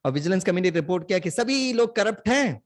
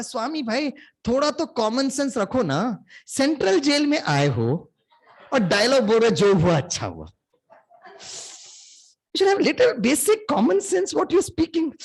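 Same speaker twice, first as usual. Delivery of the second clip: imitating a colleague's higher, slow scolding; mock a fussy colleague hissing advice; exasperated scolding; 7 semitones higher, slower than usual. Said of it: "पीछे से खींचता और कहता". (सहकर्मी की नकल, ऊँचे स्वर में झिड़की)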